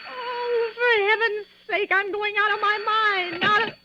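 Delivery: high, teary wail; near tears. Acted. Invalid breaking down alone in bed, high and tearful. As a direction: let it crack into tears.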